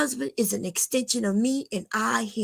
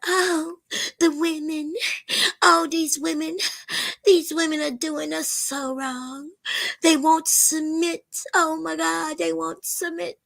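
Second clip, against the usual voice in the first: higher mocking whine of complaining men; hammed-up whining about women; mock whiny man; 6 semitones higher than usual. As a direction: whimper it like a sulky man online. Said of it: "And then you sound like a bitch".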